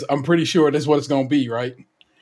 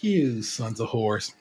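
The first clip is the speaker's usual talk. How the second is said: muttered curse; 6 semitones lower, 7 dB quieter, much slower than usual. Retold or imitated. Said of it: "I'm like".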